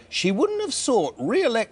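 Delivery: high-pitched mimicry of an MP; slow, high put-on voice for quoting her. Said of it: "why I did that voice".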